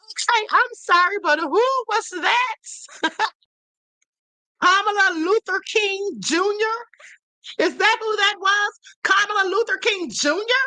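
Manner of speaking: high-pitched, amused incredulity; mock disbelief. Real, not acted. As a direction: ask it as if you can't believe what you just heard. Mocking the clip just played.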